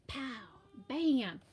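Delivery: usual speaking voice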